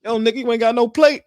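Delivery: loud, high teasing uncle voice; drunk uncle. Retold or imitated. Uncle scolding nephew about no plate, loud high mock voice. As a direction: holler it like a tipsy uncle. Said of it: "He's like".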